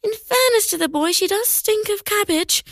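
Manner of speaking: high, amused mother voice; mum voice. Acted. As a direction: agree like a breezy mum. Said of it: "agreed Mum".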